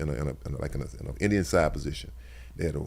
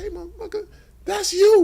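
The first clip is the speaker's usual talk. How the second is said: high, loud mock yell at a teammate; half-laughing call-out, much higher and louder; retold shout; 11 dB louder than usual. Ribbing teammates who laughed at the banner, loud mock yell.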